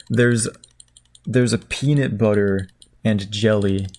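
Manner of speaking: ordinary talk, nothing marked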